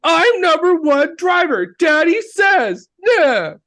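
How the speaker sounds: high, slow, whining brat shout; whiny spoiled kid. Retold or imitated. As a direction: whine like a spoiled little boy. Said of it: "He goes".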